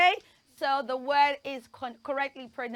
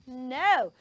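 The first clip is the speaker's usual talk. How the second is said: ordinary talk, nothing marked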